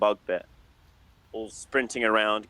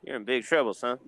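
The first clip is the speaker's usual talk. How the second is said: bartender voice. Acted. GM holds the bartender voice.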